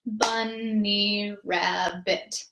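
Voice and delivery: very slow bunny rabbit, one clap per syllable; rhythmic clapping count; clapped syllables. Shown as a demonstration. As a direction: clap it out evenly. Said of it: "if you're clapping it".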